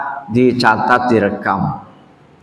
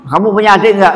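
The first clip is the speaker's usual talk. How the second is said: officer's question; 6 semitones higher than usual. Retold or imitated. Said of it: "kalau ditanya".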